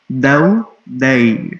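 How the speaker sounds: "دو، دئی" said slowly, each vowel gliding; careful, slow demo of "دو" and "دئی"; slow diphthong demo. Shown as a demonstration. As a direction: stretch out "دئی" to show the glide. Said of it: "ساتھ آپ کسی بھی حرف کو ملا دو".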